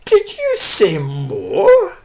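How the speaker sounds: loud, very slow theatrical boom; big hammed-up stage bellow. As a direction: boom it out, drawing every word out. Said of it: "Carrie Ann boomed".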